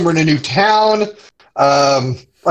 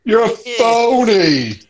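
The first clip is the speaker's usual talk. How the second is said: slow mock voice; 5 semitones higher, 3 dB louder, much slower than usual. Echoing the phony line.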